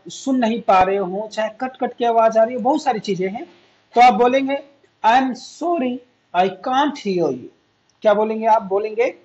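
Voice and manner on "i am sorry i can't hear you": slow, careful English to repeat; teacher models the phrase for students, slow and clear. Shown as a demonstration. Model each word slowly for the class. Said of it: "मेरे पीछे बोले तभी तो practice होगी".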